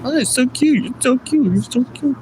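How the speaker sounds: high cooing "so cute" mimicry; mocking gushy woman. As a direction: parody the squealing dog lover. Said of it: "It was just".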